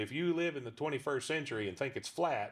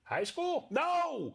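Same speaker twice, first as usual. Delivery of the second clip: put-on old man puppet squeak; 3 dB louder, much slower than usual. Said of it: "He goes".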